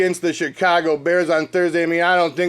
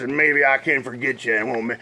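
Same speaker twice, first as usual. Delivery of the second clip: storybook ending; 6 semitones lower than usual. Pretend. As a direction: wind it down like the last page of a children's book. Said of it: "read a little thing called I Was So Mad".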